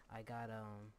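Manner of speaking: ordinary delivery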